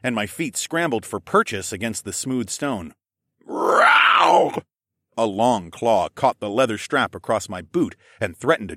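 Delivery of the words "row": loud, high bestial yowl; drawn-out beast snarl; creature roar. Acted. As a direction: roar like an attacking monster. Snarling creature leaps and claws.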